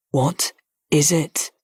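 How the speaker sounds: slow, gentle, insistent repeat. A narrator's reading. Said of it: "he repeated".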